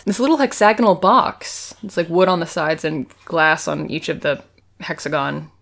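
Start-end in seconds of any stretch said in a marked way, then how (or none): none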